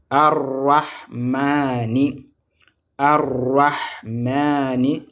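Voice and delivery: "Ar-Rahmani" stretched very slowly, syllable by syllable; long rolled r and long "maa" in "Ar-Rahmani"; teacher drawing the word out for pupils; slow model pronunciation. Shown as a demonstration. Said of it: "Regardez comment je prononce".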